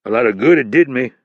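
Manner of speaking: loud, bitter sarcastic jab. A narrator's reading. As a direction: sneer it back at him. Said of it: "Hurley said sarcastically".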